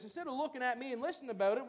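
ordinary talk

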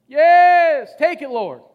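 loud fervent cry to the Lord; shouted praise. Real, not acted.

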